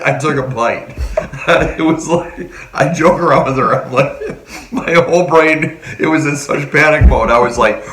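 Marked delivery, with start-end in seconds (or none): 4.2-4.7 breathless laugh. Real, not acted. Panic laugh.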